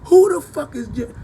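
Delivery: loud, high, indignant who the fuck; mock yell of disbelief; mock outraged shout. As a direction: bellow the question in mock disbelief. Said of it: "You say".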